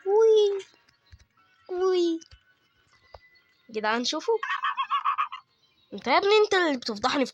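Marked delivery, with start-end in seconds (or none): 0.1-2.2 excited whoop. Real, not acted. Excited jump in game.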